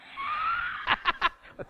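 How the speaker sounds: big real laugh; burst of laughter. Real, not acted. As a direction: laugh out at the class. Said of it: "thank you for your participation".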